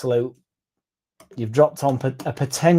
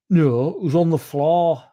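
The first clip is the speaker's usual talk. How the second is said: imitating Lance; 5 semitones higher than usual.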